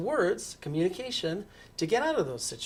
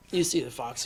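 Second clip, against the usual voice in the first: plain reading voice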